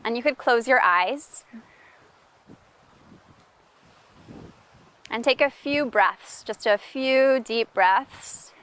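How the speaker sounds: unhurried meditation-teacher delivery; guided meditation. Real, not acted. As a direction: lead listeners gently into noticing, leaving space between phrases. Leads a mindfulness practice.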